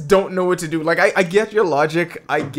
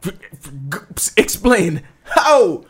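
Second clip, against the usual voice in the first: flustered; 4 semitones higher, 3 dB louder, slower than usual. Real, not acted. Flustered outburst at friend's claim.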